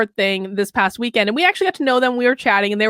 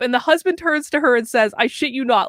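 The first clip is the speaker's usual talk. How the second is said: normal talking voice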